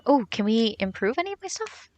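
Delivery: ordinary talk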